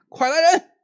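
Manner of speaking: high, loud yell for help; frightened call. Acted. Frightened magistrate yelling for his servants to come, high panicked shout. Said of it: "赶紧冲外喊".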